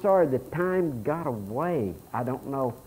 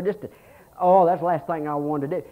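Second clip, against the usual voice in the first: ordinary delivery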